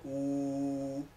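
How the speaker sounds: u held with lips rounded. Shown as a demonstration. Teacher shows lip shape for ü. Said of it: "poner los labios como de una U".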